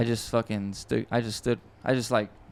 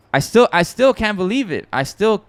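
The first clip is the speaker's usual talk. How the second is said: higher, louder, real amazement; amazed disbelief; 8 semitones higher, 6 dB louder than usual. Real, not acted. Producer marvelling that his song is out, amazed disbelief. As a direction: stumble over the words, voice lifted in wonder.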